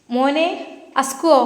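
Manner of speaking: mother calling her sleeping son. Acted. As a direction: call his name so it carries to the next room. Mother calls son from kitchen.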